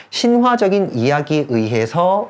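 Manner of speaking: slow, drawn-out read-out of 신화적인 이야기에 의해서; repeated reading. Shown as a demonstration. Teacher rereads the translation for students, slow deliberate reading. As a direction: stretch 신화적인 and hold the end up, unfinished. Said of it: "자, 그대로 한 번 더".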